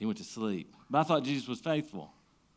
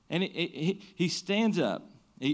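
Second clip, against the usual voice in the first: rising, louder preacher's build; preaching at full force; 9 semitones higher, 4 dB louder than usual. Acted. Pastor preaching the storm story to the congregation, full force. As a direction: preach it up to the disciples' cry.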